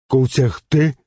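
high, loud questioning echo; puzzled simple pupil. A narrator's reading. Simple pupil puzzled by teacher's word, baffled.

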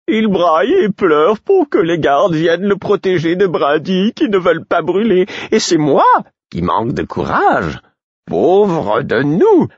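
high, loud, theatrical mock lament; hammed-up whine, stretching the final cry; wailing fool. Fool mocking Murfess's cowardice, mock wailing.

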